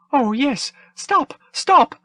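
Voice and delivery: very high, loud pleading robot voice; begging droid. Acted. The droid begs the troopers not to hit him, high and frantic. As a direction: beg like a fussy droid afraid of blows. Flustered droid cheering the halt.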